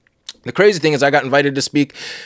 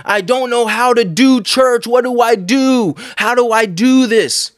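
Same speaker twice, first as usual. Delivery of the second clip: yüksek perdeden, ağır ağır, çaresiz yakarış; slow, pleading, lost and desperate; despairing cry; 8 semitones higher, 3 dB louder, slower than usual. Retold or imitated. Despairing dream cry in church.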